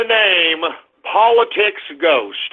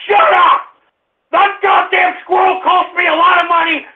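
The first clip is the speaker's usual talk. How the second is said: furious shouting; 8 semitones higher than usual. Real, not acted. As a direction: blow up at the callers. Host's mock rant at callers.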